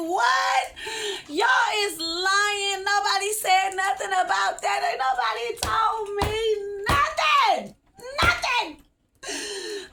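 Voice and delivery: high, loud disbelieving yell; slow, shouted outrage at the forecast; outraged shout. Retold or imitated. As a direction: shout it like you can't believe the news. Outraged reaction to week of rain.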